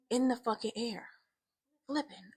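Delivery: usual speaking voice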